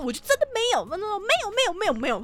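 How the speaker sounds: high shrill repeated denial; hysterical grandma. Retold or imitated. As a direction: shriek no over and over. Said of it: "她就歇斯底里". (尖声歇斯底里地否认)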